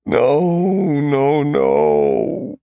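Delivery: crying, breaking on the words; sobbing no. A narrator's reading. Captive man sobbing as the drug takes him. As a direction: sob it as you give in.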